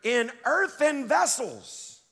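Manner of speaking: slow, weighty scripture read; reading aloud slowly with the congregation; slow emphatic reading. Shown as a demonstration. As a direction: lean on earthen and drag it out. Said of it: "Read that out loud".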